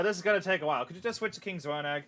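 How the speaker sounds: ordinary talk, nothing marked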